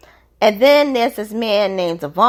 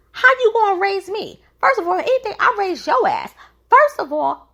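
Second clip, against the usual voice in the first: much higher, heated retelling of her rant; mock angry British; 8 semitones higher than usual. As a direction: imitate her telling him off, pitched way up. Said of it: "But she went off".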